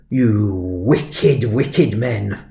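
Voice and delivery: mildly scolding, badly faked Welsh headmaster; attempted Welsh accent. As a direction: imitate a disapproving Welsh head, held back. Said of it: "can't do a Welsh accent".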